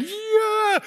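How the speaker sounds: high, drawn-out whoop; gleeful cheer. Retold or imitated. Son on the roller coaster beside his dad, gleeful whoop. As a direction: imitate a thrilled child on a ride.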